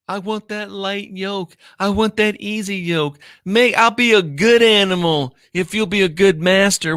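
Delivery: high, mocking voice of a willing servant; mock eager pleading. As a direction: parody a devotee begging for the yoke. Mocking believers as submissives.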